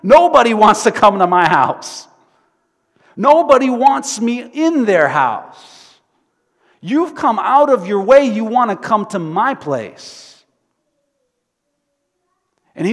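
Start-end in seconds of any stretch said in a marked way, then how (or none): 0.0-10.4 giddy, much higher and louder voice; overjoyed tax collector, high and loud; excited. Acted. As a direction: squeal with disbelieving delight. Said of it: "He gets so excited".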